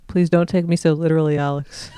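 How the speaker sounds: ordinary talk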